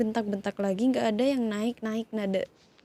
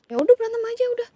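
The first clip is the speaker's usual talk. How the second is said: higher, louder, fast fed-up snap; exasperated outburst; 11 semitones higher, 7 dB louder, much faster than usual. Acted. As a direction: throw your hands up and yell it. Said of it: "Kesel banget".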